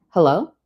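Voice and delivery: "Hello" with a sharp rise at the end; questioning tone. A narrator's reading. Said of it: "I say it like a question".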